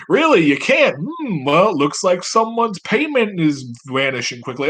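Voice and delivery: high, sing-song feigned surprise; mock-threatening benefactor. Benefactor threatening a senator's payment, high mock-innocent taunt. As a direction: toy with them in a high voice.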